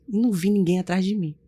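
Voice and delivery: normal talking voice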